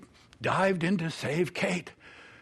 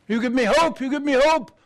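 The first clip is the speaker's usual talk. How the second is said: heartfelt hope; 8 semitones higher, 6 dB louder than usual. Acted. Edith's heartfelt parting words.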